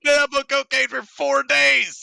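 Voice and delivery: high, strained coked-up outburst; wired, frantic. Acted. Player blurts out the character's cocaine binge, wired and frantic.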